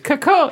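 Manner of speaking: high, slow parrot caw; parrot call. Shown as a demonstration. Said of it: "I have to screech".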